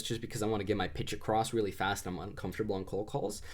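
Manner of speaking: usual speaking voice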